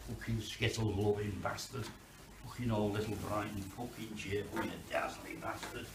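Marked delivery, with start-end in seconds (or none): none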